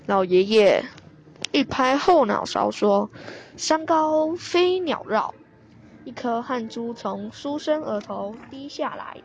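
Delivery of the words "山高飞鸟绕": high, slow, drawn-out verse; poem recitation. A narrator's reading. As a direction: intone each word as a newly made poem. Old man tries reciting his poem.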